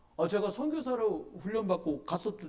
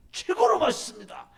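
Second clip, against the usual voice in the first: much higher, loud boast of 최고로 맛있습니다; bold ad pitch; 14 semitones higher, 7 dB louder than usual. Shown as a demonstration. An advertising model boasts the product, high and loud. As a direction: sell it with total confidence. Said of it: "이렇게 말하지".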